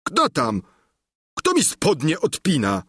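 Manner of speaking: startled sleeper calling out; mildly alarmed shout in character; startled cry. A narrator's reading. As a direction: call out as if just woken. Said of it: "zawołał".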